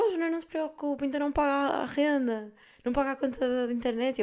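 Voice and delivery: a sympathetic teacher soothing her student. Retold or imitated. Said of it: "E a professora".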